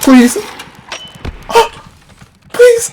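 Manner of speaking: high desperate cries of please; begging in real panic; panicked pleading shriek. Real, not acted. Chased, about to die.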